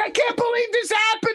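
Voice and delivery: high-pitched hysterical disbelief; mock screaming. Friend ranting on the phone, mock screaming. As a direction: imitate a friend wailing in panic. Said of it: "He's screaming and yelling".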